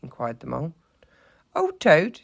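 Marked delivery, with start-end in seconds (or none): 1.5-2.2 high, loud, jovial scoff; amused exclamation. A narrator's reading. Rat joking about Toad to mole, amused. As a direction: scoff merrily at the very idea. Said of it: "said the rat, laughing".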